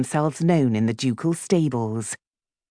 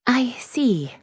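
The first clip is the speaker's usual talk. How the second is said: high, effortfully polite reply; strained politeness, pitched up; forced cordial; 11 semitones higher than usual. A narrator's reading. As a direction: fight to sound cordial. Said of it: "fighting to make her voice cordial".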